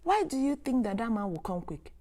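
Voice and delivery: normal talking voice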